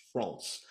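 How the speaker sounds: France with an open, rounded French vowel; French pronunciation of France. Shown as a demonstration. Naming the favourite team to the host, French pronunciation of France. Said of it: "I said France".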